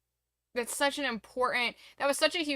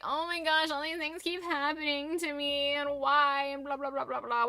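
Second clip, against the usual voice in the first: whiny self-pity; 4 semitones higher than usual. Retold or imitated. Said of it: "instead of being like".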